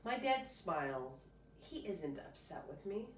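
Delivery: ordinary delivery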